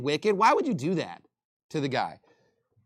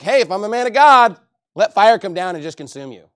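higher and louder, a defiant warning; Elijah's loud retort; 3 semitones higher, 9 dB louder than usual. Retold or imitated. Elijah answers the captain, loud defiant warning. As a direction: fire it back at him. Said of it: "And he's like".

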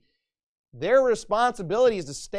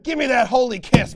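mock hearty brother; 5 semitones higher, 8 dB louder than usual. Mocking careless churchgoer.

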